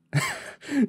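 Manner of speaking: short high-pitched laugh; amused high giggle. A narrator's reading. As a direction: burst of light, high laughter. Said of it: "Kerry laughed".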